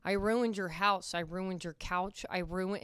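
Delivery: normal talking voice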